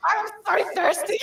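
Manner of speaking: high, loud, giggling complaint; laughing helplessly. Real, not acted. Guest thirsty from laughing, still cracking up. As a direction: squeeze the words out between laughs. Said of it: "I've just been laughing the entire time".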